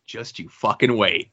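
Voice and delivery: quiet, low-pitched retold reply; knowing aside. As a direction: say it low and under the excitement, biding your time. His restrained reply to the gushing.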